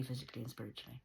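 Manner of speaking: ordinary talk, nothing marked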